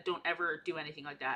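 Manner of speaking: ordinary delivery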